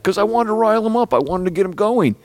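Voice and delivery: high, quick imitation of the heckler; excited retold reply. Heckler explains riling the singer up, excitedly. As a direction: act out an eager, high-pitched explanation.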